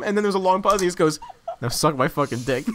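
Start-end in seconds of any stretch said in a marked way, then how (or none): 1.6-2.7 put-on deadpan it in an awful Aussie accent; worst Australian accent. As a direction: lower mock-Australian voice. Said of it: "puts on the worst Australian accent ever".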